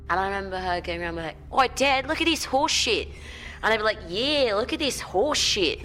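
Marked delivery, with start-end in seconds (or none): 1.5-3.1 put-on high, loud Australian kid calling to Dad; Australian accent. Australian girl calling out to her dad, imitated strong Australian accent. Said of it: "she had this really strong Australian accent". 4.1-5.8 put-on high, loud, drawn-out Australian accent; copied Australian accent. Kid copying her friend's swearing, put-on Australian accent. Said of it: "I just started doing it".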